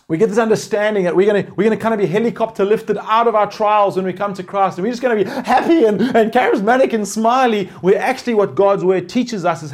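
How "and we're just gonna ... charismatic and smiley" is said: laughing. Real, not acted. Laughing at naive faith.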